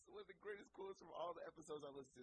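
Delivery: ordinary talk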